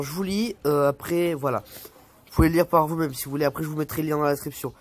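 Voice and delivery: ordinary talk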